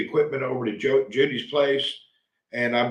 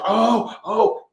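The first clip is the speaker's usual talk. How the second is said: higher, louder mock exclamations; imitating a friend; 8 semitones higher, 4 dB louder than usual. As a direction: ham up an excited friend's oh oh. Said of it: "they're just".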